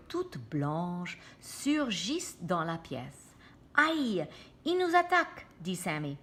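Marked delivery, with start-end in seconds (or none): 3.7-5.5 high, panicked cry of alarm. Acted. Sammy cries out in panic as creatures attack. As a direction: cry out in fright.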